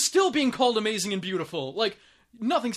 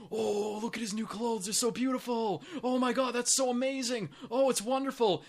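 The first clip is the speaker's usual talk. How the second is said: softly cooing mock admiration; fawning crowd; 3 semitones higher, 4 dB quieter than usual. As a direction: imitate a crowd gushing in awe. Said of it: "And everyone's like".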